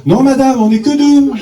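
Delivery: husband correcting the midwife, playing naive; mock innocent correction. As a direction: play dumb, a touch pleading. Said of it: "Je dis".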